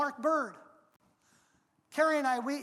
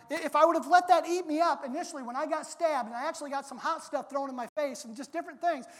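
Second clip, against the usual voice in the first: normal talking voice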